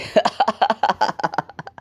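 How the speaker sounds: high-pitched staccato villain cackle; long put-on cackle in rapid bursts; mocking laugh. Deku laughs at Nezu's apology, acted cackle. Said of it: "And Deku starts laughing".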